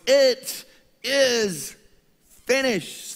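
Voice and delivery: high, loud victorious shout; loud battle cry. Retold or imitated. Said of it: "he cries out in this loud voice".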